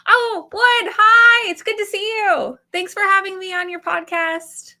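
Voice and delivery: high, gushing greeting, loud at first; impression of a friend. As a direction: greet him in an over-eager high voice. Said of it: "to describe him".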